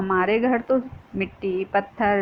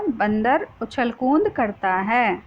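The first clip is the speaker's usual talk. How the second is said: dictating slowly; slower than usual. Shown as a demonstration. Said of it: "दूसरा वाक्य क्या बनेगा".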